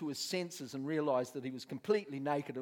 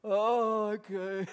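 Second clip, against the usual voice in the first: high, loud moaned giving-in; mock pained resignation; 6 semitones higher, 7 dB louder, much slower than usual. Addict refused drugs by the pastor, mock moaning acceptance. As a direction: groan the agreement, hammed up. Addict grudgingly gives in, acted.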